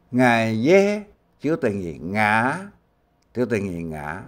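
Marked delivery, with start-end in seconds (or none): none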